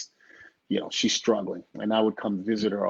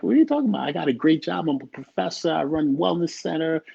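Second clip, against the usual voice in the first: high-pitched, indignant listing; reenacting an incredulous self-defense; retold defensive protest; 6 semitones higher than usual. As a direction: argue back, voice raised in pitch. Retells protesting to his mother.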